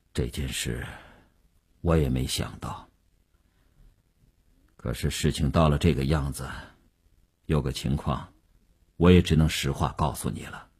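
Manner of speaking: low, subdued voice of a troubled businessman; grave Wu, reluctant. A narrator's reading. Wu owning up to Mei, low and subdued. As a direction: confide bad news quietly, low and measured.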